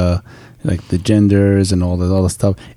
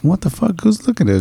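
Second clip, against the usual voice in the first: surprised; 10 semitones higher, faster than usual. Retold or imitated. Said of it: "It's like".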